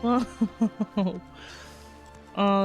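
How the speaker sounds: normal talking voice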